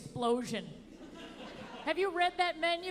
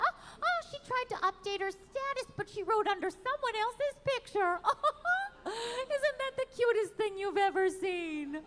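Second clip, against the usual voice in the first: put-on cooing baby talk; 8 semitones higher than usual. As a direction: coo over her like a toddler's first steps. Cooing over mother like toddler.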